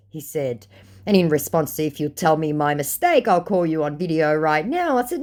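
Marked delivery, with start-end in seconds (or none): none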